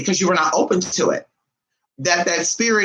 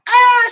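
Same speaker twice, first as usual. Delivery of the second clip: high, drawn-out "ah" scream; mock scream; 7 dB louder than usual. Friend hears the cemetery plan, mock scream. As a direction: let out a high mock horror cry.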